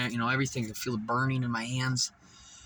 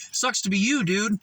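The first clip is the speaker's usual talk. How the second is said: taunting; 7 semitones higher, 4 dB louder than usual. Real, not acted. Taunting George.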